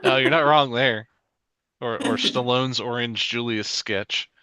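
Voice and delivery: ordinary delivery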